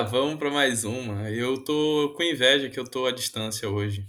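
ordinary talk, nothing marked

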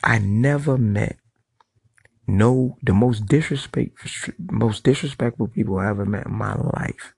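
ordinary delivery

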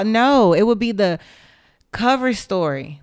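normal talking voice

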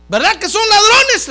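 high, loud preacher's quote; loud challenging question. Acted. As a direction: call it out to a crowd, pitch leaping up. Acts out Jesus asking the crowd. (pregunta en voz alta y aguda)

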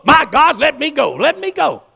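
high, loud, desperate begging; urgent plea. Retold or imitated. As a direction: act out someone bursting to get to the altar. Acted rush to the altar.

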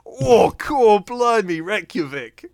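high, loud cockney tourist gushing; cockney accent. Retold or imitated. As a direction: play the excited cockney presenter. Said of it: "incredibly cockney British guy".

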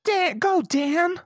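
very high-pitched Pokémon trainer call; mock trainer call. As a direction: send it into battle, high and hammed-up. Imitates trainer sending out Pokémon.

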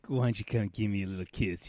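put-on low, soft, creepy kids' host; a soft, creepy kid-show host. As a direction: lure them in with a soft, sleazy invitation. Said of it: "the host was like".

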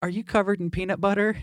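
high, put-on mom asking the baby. Mom in the viral video quizzing her baby, high put-on voice. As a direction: imitate the mom, pitched well up. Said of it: "the mom would say".

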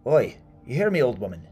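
loud, high call across a distance; shouting. Acted. Woodcutter to an old woman who won't answer, shouting. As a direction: holler gruffly to get attention. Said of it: "he shouted to her".

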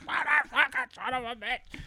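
high-pitched mock cursing fit; imitated cursing. Friend cursing as his exhaust falls off, high imitated fury. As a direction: curse up high as the furious driver.

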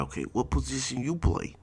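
imitating the gold-teeth teammate asking everyone; gold-teeth guy voice. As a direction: chirp the questions in his odd voice. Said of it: "he's asking everybody".

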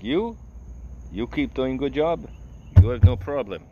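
put-on high, broken-English foreign accent; boss's crazy accent. Boss praising the teen dishwasher, accented. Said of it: "he had the crazy accent".

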